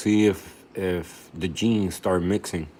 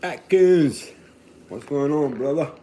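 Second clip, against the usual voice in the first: excited greeting; 5 semitones higher, 4 dB louder than usual. Real, not acted. Greeting a friend by name.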